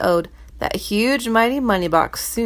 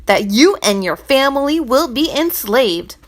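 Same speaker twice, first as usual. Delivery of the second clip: louder, raving treasurer; ranting; 4 semitones higher, 4 dB louder than usual. Acted. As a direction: rant it at the servant. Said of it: "he ranted and raved".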